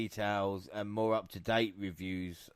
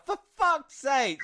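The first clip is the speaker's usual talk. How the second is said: very high, slow mock exasperation; hammed-up for fuck's sake; exasperated; 13 semitones higher, slower than usual. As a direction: groan it out in disbelief. Their reaction imitated.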